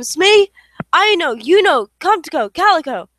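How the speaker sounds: loud searching call. Acted. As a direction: call across the mountain. Folks call for Smee and dogs.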